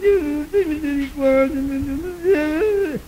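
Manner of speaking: high, drawn-out mock wailing; choro encenado. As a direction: wail softly to God, high and slow. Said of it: "comecei a chorar". (choro agudo e arrastado, imitado)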